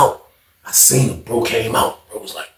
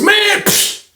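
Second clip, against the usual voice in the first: high, dismissive scoff; retold scoff. Roommate caught out, scoffing high. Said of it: "that's all you heard was".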